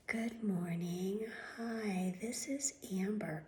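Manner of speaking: whispered, slow, overly soft receptionist; mocking receptionist whisper. Mocking the doctor's office receptionist, soft whispered voice. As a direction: mimic her limp, whispery phone voice. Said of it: "this is how they talk".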